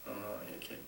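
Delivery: soft, low pastoral exhortation; tender. Real, not acted. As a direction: gently coax the congregation.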